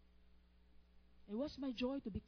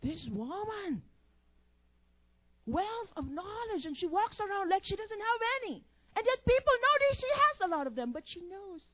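building admiration; 8 semitones higher, 12 dB louder than usual. Retold or imitated. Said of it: "I'm like".

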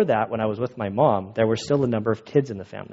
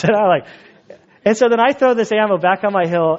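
ordinary delivery

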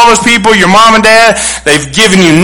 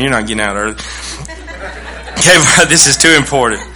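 teasing laugh; 4 semitones lower, 6 dB quieter than usual. Real, not acted. Teasing the congregation.

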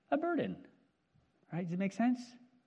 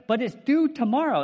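higher, louder whiny protest; panicked student; 9 semitones higher, 10 dB louder than usual. Retold or imitated. Student Jeff pleading to counselor, whiny. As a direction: protest like a desperate teen. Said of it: "I know".

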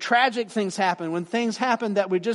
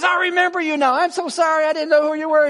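much higher and louder, bursting with recognition; excited; 10 semitones higher, 8 dB louder, faster than usual. Retold or imitated. Friend calling back next day, high excited gush. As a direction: gush it like you just remembered an old friend. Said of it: "all excited".

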